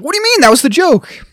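high-pitched, put-on protest; mock protest. Co-host retelling Frank defending his joke, mock protest. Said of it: "And he's like".

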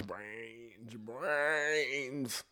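soft, drawn-out zombie groan; eerie zombie call, swelling slowly; zombie moan. Acted. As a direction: stretch the word out like a hungry zombie. Imitating zombie craving brains.

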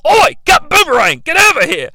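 high, loud mock Aussie holler; Australian accent. Construction worker summoning a mate, loud hammed-up Australian accent. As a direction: yell across the site in broad Australian. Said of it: "the Australian construction workers, like I said".

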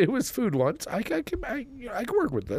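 high squeaky dog voice; excited dog voice. Retold or imitated. Voicing the eager dog again.

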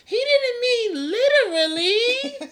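put-on squeaky, slow, laughing kid voice; laughing high. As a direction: laugh through it in a high child voice. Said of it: "And Kyrie's like".

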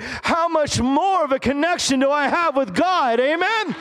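high, building revival fervor; full-force preaching. Real, not acted. Preacher on his connection with God, full-force preaching. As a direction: build to an impassioned high cry of praise.

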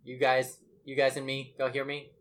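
ordinary talk, nothing marked

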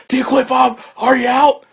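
high, insistent mock yell; mimicked referee badgering. Imitating the referee asking if he would quit, high and insistent. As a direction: pester him loudly for a submission.

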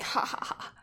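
breathy fake ha ha ha, teasing; mock taunting laugh. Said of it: "I'm like".

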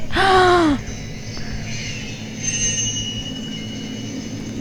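soft, airy gasp of shock. Real, not acted. Viewer watching a stabbing on screen, shocked gasp. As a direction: suck in air at the scare.

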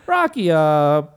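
high, hammed-up fake Italian gibberish; mock Italian priest. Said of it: "my Italian".

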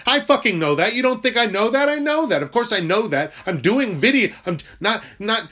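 loud, high-pitched exasperated yelling; angry outburst. Real, not acted. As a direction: explode in defensive frustration. Explodes at being called emotional.

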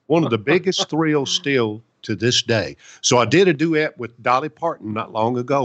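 ordinary talk, nothing marked

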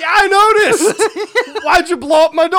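high, loud yelling with laughter; hammed-up outraged demand; mock fury. Wizard confronting visitor about his door, furious.

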